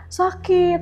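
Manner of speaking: mild mock whimper, as if hurting; retold moan.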